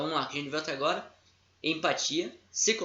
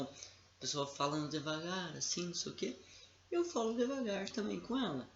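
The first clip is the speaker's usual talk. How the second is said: slow, soft demo of matching a slow talker; drawn-out, quieter demo speech; 3 semitones higher, 6 dB quieter than usual. Shown as a demonstration. Coach to students, showing how to match a slow speaker's pace. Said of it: "a pessoa fala num tom devagar".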